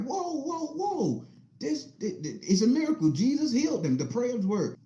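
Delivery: high, breathy-free astonished gasps of whoa; amazed onlookers. Retold or imitated. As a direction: play stunned witnesses to a miracle. Amazed crowd at hospital.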